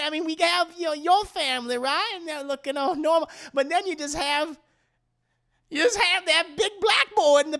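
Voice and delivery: high-pitched gossiping society-lady caricature; catty buzzard women. Acted. Buzzard women needle Leanne about her Christmas card, high catty caricature. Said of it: "the Buzzard women are just like".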